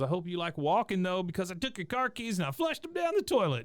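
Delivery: high-pitched mock spite; pissed-off Luann. Niece taunting Hank about his car keys, mock pissed off. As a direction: imitate a gloating, ticked-off woman, voice climbing well up. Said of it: "really pissed off at Hank".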